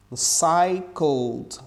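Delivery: very slow "cycled", E dropped; silent E in cycled. Shown as a demonstration. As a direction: stretch out "cycled" with no E. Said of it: "essa letra E não é pronunciada".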